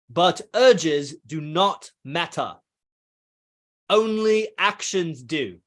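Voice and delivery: slow, lightly put-on British accent reading a quote. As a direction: read it in a mock British accent, savouring each line. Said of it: "I'll do my British accent".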